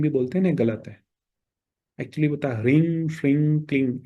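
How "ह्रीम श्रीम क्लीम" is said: ह्रीम श्रीम क्लीम each closing through the nose; nasal ending. Shown as a demonstration. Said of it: "it ends on the nose".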